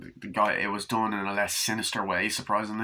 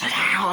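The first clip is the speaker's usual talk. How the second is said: cat yowl; 10 semitones higher, 6 dB louder than usual. Retold or imitated. Mimicking the cat's yowl.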